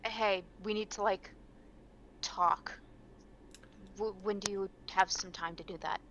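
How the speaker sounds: soft, hesitant aside; quietly asking for a private word; under the breath. Acted. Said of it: "super quietly under my breath".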